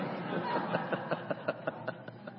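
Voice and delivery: breathy chuckle after punchline; quiet real laugh; soft laugh at own joke. Real, not acted.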